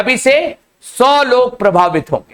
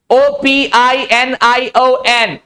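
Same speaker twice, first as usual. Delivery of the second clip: letters called out one by one, slowly; slow spelling; much slower than usual. Shown as a demonstration. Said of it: "ओ को अक्सर जगह आ पढ़ते हैं". (धीरे धीरे अक्षर बोलते हुए)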